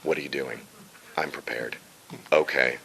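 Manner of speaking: deep, very soft, few-word delivery; low cautious hero. Acted. Hero's one or two words, low and soft. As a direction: drop low and say it quietly. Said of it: "he just talks down here".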